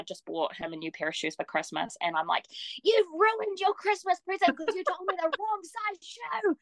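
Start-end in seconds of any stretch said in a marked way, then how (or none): 2.8-6.6 high, slow, tearful whine; upset whining. Retold or imitated. Scolding her husband over the wrong shoe size, a high teary wail. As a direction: wail it like you're about to cry.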